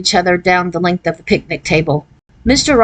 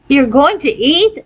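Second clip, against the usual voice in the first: amazed; 7 semitones higher, slower than usual. A narrator's reading.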